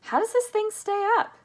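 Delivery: very high, loud, incredulous question; alarmed cry. A narrator's reading. Grandpa Joe asking about the hovering elevator, alarmed cry. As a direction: squeal it in alarm. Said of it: "cried Grandpa Joe".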